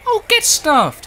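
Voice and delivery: high, loud and slow exasperated yell; frustrated outburst. Real, not acted. Spotted by enemy, frustrated.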